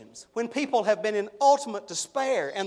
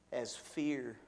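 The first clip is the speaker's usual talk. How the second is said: hushed; 6 semitones lower, 10 dB quieter, slower than usual. Real, not acted. Preacher describing fear creeping.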